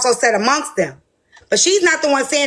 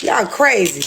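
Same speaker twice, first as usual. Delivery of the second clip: ordinary talk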